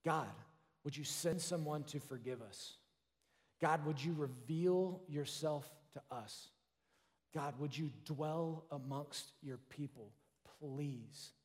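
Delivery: a hushed, worn-out cry for help; desperate plea. Retold or imitated. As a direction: beg God quietly, nearly spent. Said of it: "God's people were crying out".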